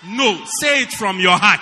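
wife's shout. Retold or imitated. Preacher voicing the wife, shouting at her husband. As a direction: shout the order at him.

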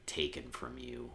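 low, subdued retelling of a bitter reply; quiet retold bitterness. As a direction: act out a wounded man, soft and low. Acting out bitter Harvey Dent.

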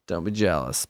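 low, soft smug boast; mock cool teen. Teen showing off his matching outfit, low smug voice. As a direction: play it like a smug kid showing off.

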